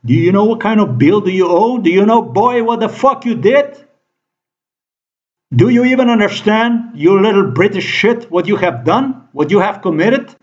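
pitched well up, furious questioning; angry high rant. Real, not acted. Ranting at the British who wronged him, high and furious. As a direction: demand answers, voice climbing high. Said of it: "Oh, not own".

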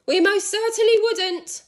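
put-on high, loud old woman's cry; Missus Twit crying out. A narrator's reading. Missus Twit calling down to her husband, high and loud. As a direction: shout it up high like a frantic hag. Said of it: "cried Missus Twit".

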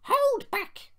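high-pitched, loud stage whisper; squeaky plotting coach; conspiratorial. A narrator's reading. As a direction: pass on a secret order in a squeaky voice. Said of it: "whispered Lucy to Beatrice".

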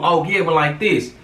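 normal talking voice

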